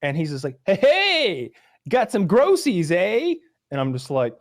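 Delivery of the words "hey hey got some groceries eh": high, loud, over-cheery salesman; mocking bug man. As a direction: put on a grating-friendly high holler. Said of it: "bug man came to my house".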